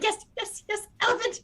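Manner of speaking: put-on high, giddy child's cheer; excited little child. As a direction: bounce on every word like a thrilled kid. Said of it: "an excited little child".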